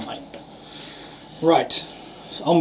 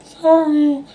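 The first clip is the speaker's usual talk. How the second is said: much higher, louder mock apology; high-pitched sorry; 13 semitones higher, 5 dB louder than usual. Apologising for the deafening noise, in a high put-on voice. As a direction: squeak out an apology.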